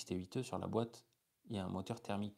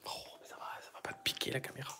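whispering; 4 semitones higher than usual. Real, not acted. Whispering reassurance in the dark.

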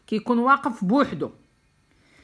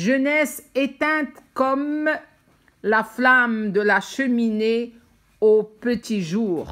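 very slow, louder recited French; monologue recitation; 3 semitones higher, 4 dB louder, much slower than usual. Acted. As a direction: recite the lines slowly, phrase by phrase. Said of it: "اش كتقول هاد لا فام".

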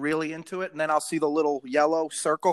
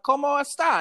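higher-pitched, breezy confident greeting; self-mocking confident Spanish greeting; 7 semitones higher than usual. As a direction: toss out the Spanish with full confidence. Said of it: "I say it sometimes with such confidence".

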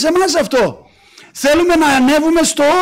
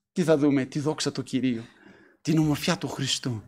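much softer, lower voice; hushed reverence; 9 semitones lower, 12 dB quieter than usual. Real, not acted. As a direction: drop low and soft, in reverent awe. Hushed awe at God's glory.

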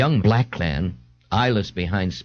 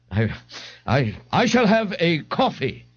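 slow, higher, grandly pompous; 8 semitones higher than usual. A narrator's reading. Ignatius orders at the bar from the bartender, grandly. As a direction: draw it out as a lofty order. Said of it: "Ignatius said grandly".

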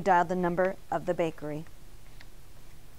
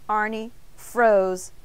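plain reading voice

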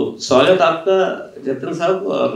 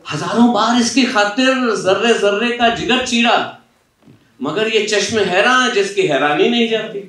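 reciting ghazal; 5 semitones higher than usual. Acted. Interviewer quoting Faiz to the author, declaiming. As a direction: declaim the couplets to the hall.

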